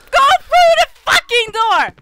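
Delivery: high, loud frantic yell; terrified screaming at the screen; panicked scream. Real, not acted. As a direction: shriek the order in real fear. Yelling at game in panic.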